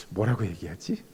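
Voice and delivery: low, quiet musing; thinking to oneself. Retold or imitated. Wondering how to answer God's offer, musing quietly. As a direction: voice a private thought, dropped far down and soft. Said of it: "라는 생각을 한 적이 있어요".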